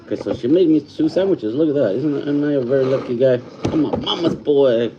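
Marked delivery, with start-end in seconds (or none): none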